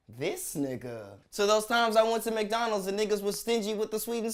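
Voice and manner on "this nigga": low, drawn-out, falling disbelief; unimpressed catchphrase. Acted. Comic reacting to stingy chicken scoop, unimpressed. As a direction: sink slowly into weary judgment. Punchline of exasperated complaint.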